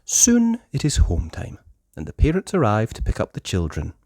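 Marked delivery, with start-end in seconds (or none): none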